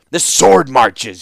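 loud, slow, emphatic retort; shot back. A narrator's reading. Warlord correcting the young ruler's name for the land, shooting back. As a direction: fire the correction straight back. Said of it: "shot back the warlord".

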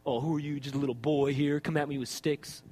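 low, taunting giant voice; deep mocking taunt; Goliath. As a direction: sneer down at a small challenger, low and soft. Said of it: "Goliath says".

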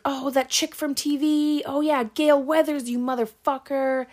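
high-pitched, frantic mock answer; imitated defiant outburst. Cornered girl answering the killer's quiz, high mock defiance.

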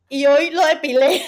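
shouted out through real laughter; laughing shout. Real, not acted. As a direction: blurt the punchline laughing loudly. Punchline told through laughter. (gritando entre carcajadas)

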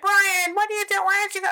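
very high, loud mock-mother voice; yelling mom imitation. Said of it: "my mom was yelling at me".

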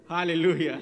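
loud hallelujah. Real, not acted. Preacher exclaiming.

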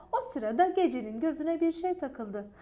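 ordinary delivery

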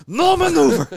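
loud, high laughing shout; Al Pacino voice. Acted. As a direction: bark it, laughing. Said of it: "He talks like this, like Al Pacino".

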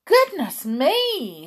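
high, slow alarmed gasp of a word; frightened. Acted. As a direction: sound startled and scared. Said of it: "quite frightened".

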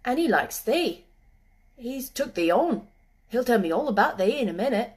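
country dialect, friendly and confiding; a hint of rustic boy; lightly voiced moor boy. A narrator's reading. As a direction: lightly play a moor lad reassuring a girl.

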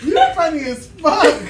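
loud laugh then slowed words. Real, not acted. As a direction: laugh and say it slowly. Laughing at friend.

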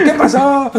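high, laughing "¿Qué pasó?" greeting; laughing Mazatlán-style "¿Qué pasó?"; mock Mazatlán greeting. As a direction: mimic a friend answering the phone. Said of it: "usted te dice de".

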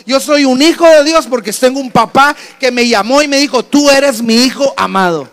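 loud, driving sermon climax; impassioned pulpit declaration; full-force preaching. Real, not acted. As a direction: preach it out with rising force, slowing on the final words. Preacher builds to emphatic climax.

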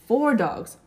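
ordinary delivery, nothing marked

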